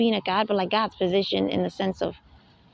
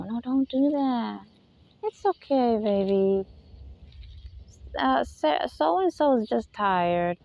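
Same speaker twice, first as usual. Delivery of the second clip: put-on slow, gentle reminder to a toddler; acted tender parent, drawn out; soothing parent; slower than usual. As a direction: gently soothe and correct a baby. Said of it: "when you tell the kid".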